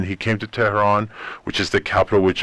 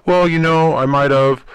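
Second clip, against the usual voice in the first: lightly acted higher, louder hedging; mock-hesitant higher voice; noncommittal reply; 8 semitones higher, 5 dB louder than usual. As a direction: imitate someone hedging, pitched up.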